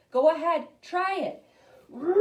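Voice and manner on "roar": put-on loud, high demo roar for the kids; biggest lion roar. Shown as a demonstration. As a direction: show them the biggest roar. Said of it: "Can you give me the biggest lion roar".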